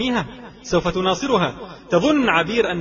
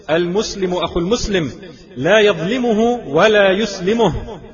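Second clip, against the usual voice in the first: louder, higher declamation, slowing on the last phrase; emphatic hadith quote; 4 semitones higher, 6 dB louder than usual. Acted. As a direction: proclaim it forcefully, building to the end. Preacher declaims hadith with force.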